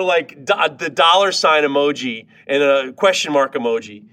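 usual speaking voice

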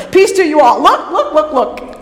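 high, eager, loud greeting; giddy, insistent excitement; excited Jesus. Acted. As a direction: burst in and urge a friend to look. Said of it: "Does it sound a lot more like Jesus".

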